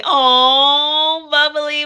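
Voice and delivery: ordinary talk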